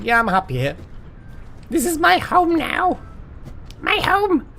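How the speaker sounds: high, squeaky, yappy little creature; Kobold voice. Pretend. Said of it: "Why am I doing Kobold voice?".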